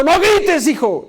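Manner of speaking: high-pitched acted shout at a son; mock parental scolding, pitch leaping up; parent yelling. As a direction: yell it like a hypocritical shouting parent. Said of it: "porque tú estás gritando, eres bien gritón".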